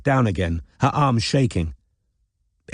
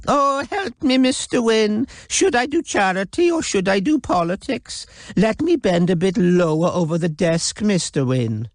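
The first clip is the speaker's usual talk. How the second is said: much higher mocking imitation of a simpering flirt; imbecilic mock; 11 semitones higher than usual.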